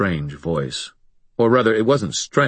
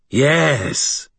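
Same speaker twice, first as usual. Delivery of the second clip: alien voice; 8 semitones higher, 4 dB louder than usual. Acted. Sharkface speaks.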